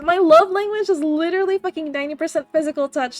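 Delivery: ordinary delivery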